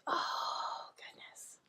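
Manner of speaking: breathy hushed oh goodness; whispered dismay. Retold or imitated. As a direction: whisper it, suddenly realizing. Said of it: "I was like".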